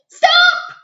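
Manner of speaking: very high, loud shout; piercing shouted stop. A narrator's reading. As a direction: yell it to halt them. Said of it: "Gertie shouted".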